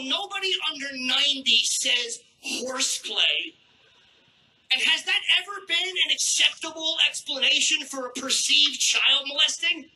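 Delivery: normal talking voice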